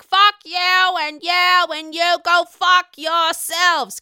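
slow, loud mock tirade; imitating angry Dolores. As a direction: hurl it like a storming-out exit. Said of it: "She stomped out and she's like".

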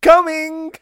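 put-on high, loud cheerful toddler reply. Toddler inside answers his nanny, high and chirpy. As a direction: chirp it like a carefree little kid.

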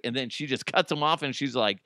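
usual speaking voice

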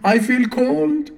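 slow, high voice from underwater. Acted. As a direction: speak as if submerged, slow. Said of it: "like he was speaking from underwater".

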